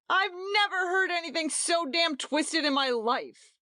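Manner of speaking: high, loud shout; a host shouting at the guest. A narrator's reading. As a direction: bellow it at the guest. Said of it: "shouted Marky".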